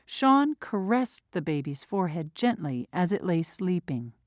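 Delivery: normal talking voice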